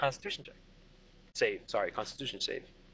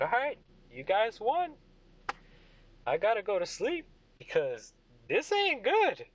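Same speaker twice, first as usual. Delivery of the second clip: put-on higher-pitched wobbly drunk admitting defeat; Craig; 7 semitones higher than usual. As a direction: give up woozily, voice lifted. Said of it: "And then he's like".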